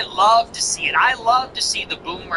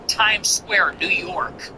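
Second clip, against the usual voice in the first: ordinary delivery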